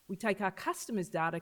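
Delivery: normal talking voice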